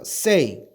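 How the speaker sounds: "say" pitched high and loud; model word to repeat. Shown as a demonstration. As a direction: call out "say" for students to copy. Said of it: "따라해보세요".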